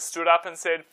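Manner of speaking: plain reading voice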